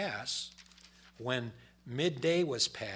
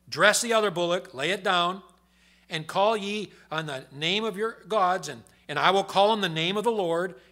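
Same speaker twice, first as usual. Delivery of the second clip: loud, higher booming scripture reading; preaching scripture; 5 semitones higher, 10 dB louder than usual. Acted. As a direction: proclaim it from the pulpit. Reads scripture at full force.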